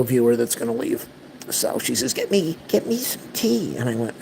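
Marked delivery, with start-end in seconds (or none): 2.1-3.8 much higher, put-on demanding mother; whiny mom impression. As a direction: squeak it out like a needy mom. Voicing mom's demand in story.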